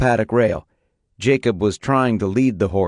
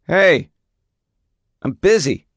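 high, loud, irritated brush-off; irritated shout; 8 semitones higher, 5 dB louder than usual. A narrator's reading. Son yelling at his father to leave him alone, irritated shout. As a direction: shout him off, you're busy.

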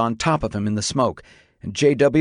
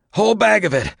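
high-pitched rasping report; strained, raised raspy voice; 10 semitones higher than usual. A narrator's reading. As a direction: rasp it out over the radio. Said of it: "rasped Grossman".

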